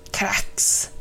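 viskat krax; tyst krax. Acted. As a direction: viska krax tyst som en skamsen kråka. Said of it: "sa kråkan tyst". (viskat, tyst krax)

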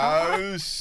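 high, carrying name call. Acted. Bully calling out to Klaus, a high shout. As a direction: holler the name to get his attention. Calls out to the boy.

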